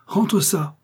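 high, sudden exclamation; exclaimed order. A narrator's reading. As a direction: order it with mocking disgust. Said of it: "s'écria le bourreau".

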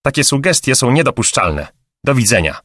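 offended, firm goodbye; indignant. A narrator's reading. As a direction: cut the caller off with mild outrage. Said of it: "zawołała z oburzeniem".

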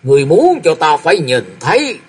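put-on an old father scolding his son, drawn out at the end; narrated anger, voice lifted; angry, trembling voice. A narrator's reading. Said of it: "tức giận rung giọng lên".

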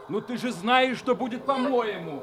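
very high, laughing madness; maniacal laughing. Acted. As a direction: cackle through the threat. Husband laughing maniacally, dragging her.

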